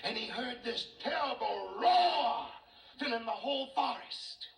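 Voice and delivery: high, rising narration swelling on the roar; animated storytelling. A narrator's reading. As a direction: build the tale up with excitement.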